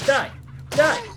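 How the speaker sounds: loud, high battle yell; attacking shout. Real, not acted. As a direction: shout it at the enemy as you strike. Attacking an enemy in game.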